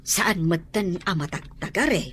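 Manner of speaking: mother quietly confiding her worry to her husband; worried mother. Acted. Mother to husband about their child, fretting softly.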